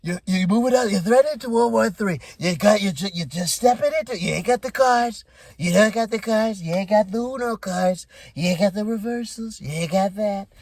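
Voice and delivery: loud, high imitation of a scolding president; hammed-up berating, hammering each line; Trump. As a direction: raise the voice into a mock tirade. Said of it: "Trump got louder. He was like".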